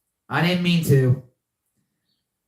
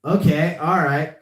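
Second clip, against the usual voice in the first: usual speaking voice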